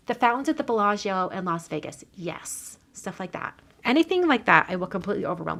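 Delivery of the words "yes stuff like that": whispered aside. Real, not acted. Quiet aside while crafting.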